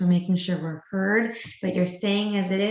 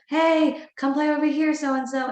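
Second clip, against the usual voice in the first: higher, louder friendly call across the yard; calling out to a child; 7 semitones higher, 3 dB louder than usual. Retold or imitated. Teacher on the play structure calls a child over, bright and loud. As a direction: sing out to a kid to pull them over.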